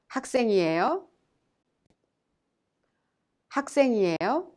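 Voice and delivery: 학생이에요? ending with a clear upward lift; rising question tone. Shown as a demonstration. Teacher leading learners in a question, rising at the end. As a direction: lift the pitch on the final 요 of 학생이에요?. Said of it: "you raise the tone".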